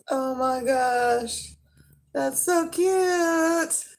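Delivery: high, slow gushing over something cute; cute squeal. Real, not acted. Vlogger gushing to viewers over a cute shirt. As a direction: melt at how cute it is.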